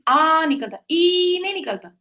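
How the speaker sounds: आ drawn out like a cry of pain, ई flat beside it; pained aa stressed. Shown as a demonstration. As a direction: stress the आ as a groan of hurt. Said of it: "देखिए".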